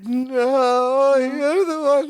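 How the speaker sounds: high, slow blubbering mockery; hammed-up wailing. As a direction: stretch it into a comic sobbing wail. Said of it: "he always goes".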